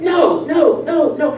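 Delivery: high screaming no, retold; child screaming. Little kid denied candy by his mother, screaming. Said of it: "he just started screaming".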